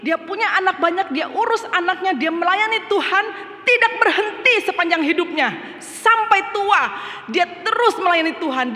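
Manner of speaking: high, loud, fervent preaching; preaching at full force. Acted. Preacher extols his late mother's service to the congregation, in full-force preaching. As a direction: lift the congregation with her faithful service.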